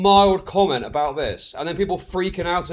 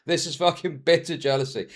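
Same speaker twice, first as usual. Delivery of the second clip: usual speaking voice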